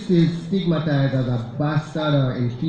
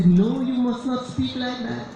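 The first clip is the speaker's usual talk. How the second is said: higher, prim parental correction; a parent correcting a child's patois; a lightly voiced strict parent; scolding parent; 7 semitones higher than usual. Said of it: "they will try to say".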